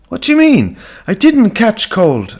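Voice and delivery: protest, a little too eager; girl insisting she's fine, voice up high. Acted. Girl protests to her mother.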